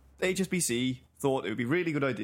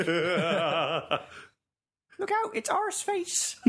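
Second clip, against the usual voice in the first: very high, slow put-on Bristolian accent; 4 semitones higher, slower than usual. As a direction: shout it like a Bristol villager in a game. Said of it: "fun to be Bristolian".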